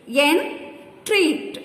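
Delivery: "Entreat" in two beats, En, treat; syllable by syllable. Shown as a demonstration. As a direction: clear pause inside "Entreat" after En. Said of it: "Two syllables".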